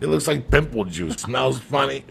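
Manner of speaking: put-on young guy refusing cheese from his date, loud protest; indignant retort. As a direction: push back like a stubborn Jersey kid. Acted argument with the girl.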